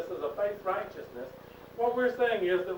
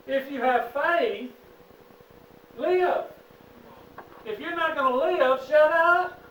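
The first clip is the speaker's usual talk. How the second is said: higher and louder, pounding each phrase; preaching hard; 7 semitones higher, 6 dB louder than usual. Real, not acted. Teacher preaching at the class, higher and louder. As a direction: preach it, full force, to the class. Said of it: "I'm gonna preach a minute now".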